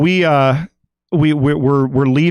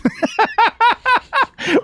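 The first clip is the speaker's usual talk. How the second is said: laugh. Real, not acted. Laughing at his own mistake.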